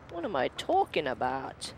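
normal talking voice

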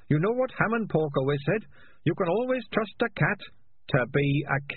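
timid high squeaky voice; eager but meek underling; meek high rat. Acted. Sardines quotes old leader.

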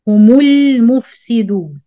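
slow, the mim voiced as Humul; recited with damma. Shown as a demonstration. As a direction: hold Humul mufsidun slow and joined. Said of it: "called this meme to be Dhamma".

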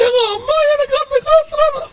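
very high, thin whimpering wail; hammed-up tearful wife's whine; mock crying wife. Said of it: "எதுக்காக அழுவுற". (அழும் மனைவி போல கீச்சுக் குரலில் நடிப்பு)

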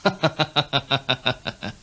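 soft, put-on friendly chuckle; a scheming king's soft fake laugh; feigned casual laugh. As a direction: laugh lightly to hide his fear. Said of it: "假装若无其事咁向呢个少年查问".

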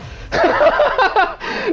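sudden high burst of laughter. Real, not acted. Laughs at the last enemy.

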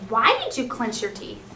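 ordinary talk, nothing marked

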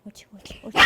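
hushed whisper of praise. Real, not acted. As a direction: whisper so the dog stays calm.

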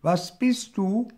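calling out. A narrator's reading. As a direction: call across the water. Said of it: "rief der kleine Hirsch". (hoher, lauter Zuruf des kleinen Hirschs)

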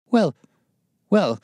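high, edgy hesitation; nervous. A narrator's reading. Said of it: "said the resident patient in a nervous fashion".

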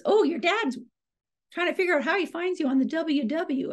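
high imitation of mom; mom's voice. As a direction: lift into a chirpy mother voice. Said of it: "Mom goes".

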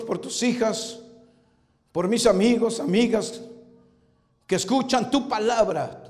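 prayer. Real, not acted. Leads a prayer.

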